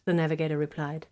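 usual speaking voice

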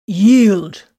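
forceful shouted order; loud demand. A narrator's reading. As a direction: demand it hard. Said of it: "the captain demanded".

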